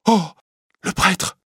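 high startled gasp of horror; shocked recognition. Acted. Bohémienne recognising the priest, startled. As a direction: recoil in shock, voice jumping up.

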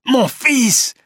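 high, emotional shout; father's cry. A narrator's reading. Jean Cornbutte sees his dying son, high cry. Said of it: "crie Jean Cornbutte".

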